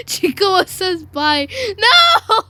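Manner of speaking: high, loud wail rising to a scream; playful wail. Pretend. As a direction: wail it out, building to a shriek. Mock wail at goodbye.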